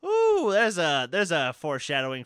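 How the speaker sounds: milk the ooh like a crowd reacting; teasing ooh. Real, not acted. Reacting to his friend's cheeky jab, a high teasing ooh.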